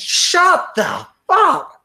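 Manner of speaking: shrieked, high, slow pretend outburst; a mock, screeched outburst of pent-up frustration. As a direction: let the exasperation burst out. Imagined outburst at student.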